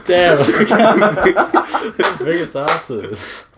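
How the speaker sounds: talking through big laughs; words squeezed out between laughs; laughing. Real, not acted. Laughing hard while speaking.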